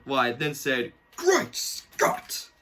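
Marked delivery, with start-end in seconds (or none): 1.2-2.5 high, breathy astonished exclamation; Doc impression. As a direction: gasp it out like the shocked old scientist. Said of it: "my best impression of Doc".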